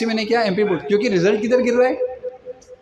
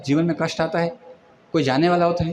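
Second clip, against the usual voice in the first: ordinary talk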